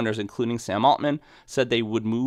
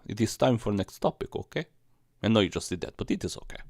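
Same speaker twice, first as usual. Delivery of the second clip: put-on Russian accent; 4 dB quieter than usual. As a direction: play it as a stern Russian host. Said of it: "I can do the Russian accent".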